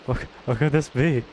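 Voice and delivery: ordinary delivery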